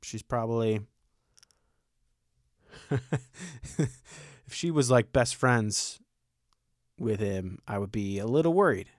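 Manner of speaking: ordinary talk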